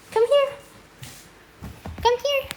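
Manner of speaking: high, loud sing-song call to the cat; coaxing pet voice. Real, not acted. Calling the cat to come. (suara tinggi memanggil kucing)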